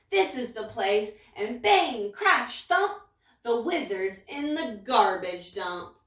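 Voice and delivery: usual speaking voice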